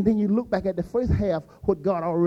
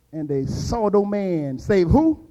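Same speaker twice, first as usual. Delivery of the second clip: ordinary delivery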